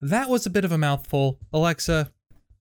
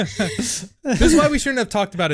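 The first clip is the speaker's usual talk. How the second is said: laughing; 6 semitones higher, 4 dB louder than usual. Real, not acted. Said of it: "Can't even keep a straight face".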